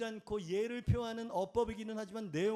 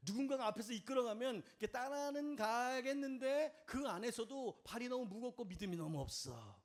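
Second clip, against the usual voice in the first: usual speaking voice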